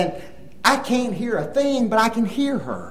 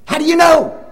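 wife's question; 8 semitones higher, 7 dB louder than usual. Retold or imitated. Wife asking in retold dialogue.

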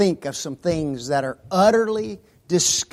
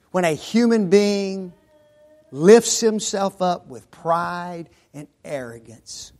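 ordinary talk, nothing marked

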